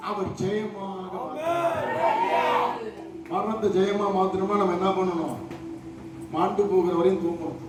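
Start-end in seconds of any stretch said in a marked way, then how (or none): 0.1-2.7 high, ringing rally to the congregation; fervent preaching. Real, not acted. Preacher urging the congregation to clap and say hallelujah, fervent. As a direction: preach it with full fervour, pitched high.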